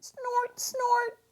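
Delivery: high pig snores; piggy snoring upstairs; snoring snorts. A narrator's reading.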